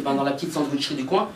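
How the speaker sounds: patient owning up to his doctor; sheepish mumble. Retold or imitated. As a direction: admit it low and quietly, a bit embarrassed.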